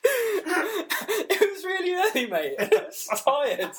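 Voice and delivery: high, squeaky laughing speech. Real, not acted. Admitting to co-host he missed the race, laughing helplessly. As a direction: squeeze the words out through helpless laughter. Confessing he skipped the race, cracking up.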